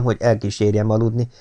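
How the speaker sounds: plain reading voice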